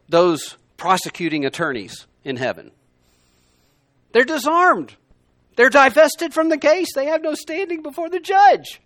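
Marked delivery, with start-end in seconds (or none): 4.1-8.8 loud, high-pitched exultant declaration; triumphant proclamation. Real, not acted. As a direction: proclaim the victory at full pitch, high and ringing out. Triumphantly declaring the accusers defeated.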